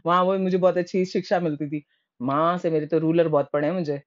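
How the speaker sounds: ordinary talk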